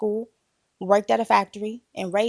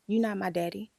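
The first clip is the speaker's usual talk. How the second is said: normal talking voice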